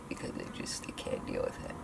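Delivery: soft, breathy whispered giving-up; defeated whisper. Real, not acted. To viewers about hair frustration, defeated whisper. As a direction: barely breathe it out.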